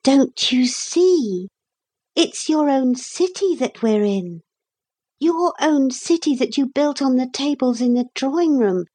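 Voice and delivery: put-on high-pitched girl revealing a secret; high, eager girl; excited girl character. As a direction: make them see it, as a little girl. Narrator voices girl Lucy revealing secret.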